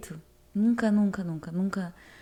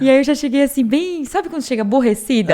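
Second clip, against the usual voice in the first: real laughter through the words, louder; laughing while telling; 3 semitones higher, 8 dB louder than usual. Real, not acted. Telling a friend about arriving upset at church, laughing. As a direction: tell it with an amused laugh breaking through.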